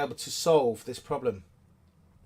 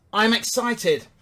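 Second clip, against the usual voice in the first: excited; 6 semitones higher, 6 dB louder, slower than usual. Pretend. Quoting his excited email.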